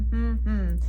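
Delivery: closed-lip hum, one beat per syllable; hummed syllables. Shown as a demonstration. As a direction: hum out each syllable of banana with lips shut. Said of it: "you could hear how many times".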